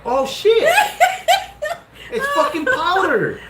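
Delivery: high, laughing oh shit; shocked realization. Retold or imitated. Cracking the door and finding powder, high laughing surprise. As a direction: pitch jumps up in disbelief.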